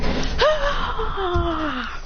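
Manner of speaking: long high, falling yell; battle yell. Acted. Everyone shooting strongest attacks, battle yell. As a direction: sustain a fighting cry.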